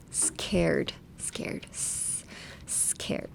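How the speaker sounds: SCARED with a long hissed s, the rest hushed; drawn-out airy S. Shown as a demonstration. Teacher showing the S in scared, hushed airy demo. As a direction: let air rush between the teeth on the S of scared. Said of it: "The S in scared".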